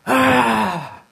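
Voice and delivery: loud, high outburst of anguish; acted groan. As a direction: groan it out suddenly, open-mouthed. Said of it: "he just went".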